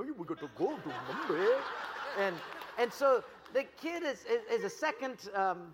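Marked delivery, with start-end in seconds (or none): 0.0-1.6 quick put-on Indian accent; mock Indian accent. Shown as a demonstration. Showing how the family from Bombay talks, mock Indian accent. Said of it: "so they, they talk like this".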